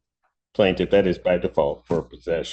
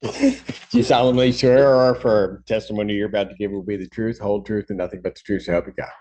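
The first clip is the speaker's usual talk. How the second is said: fast, rattled-off oath; rote court oath; 2 semitones higher than usual. Real, not acted. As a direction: rattle it off from memory. Judge swearing in witness.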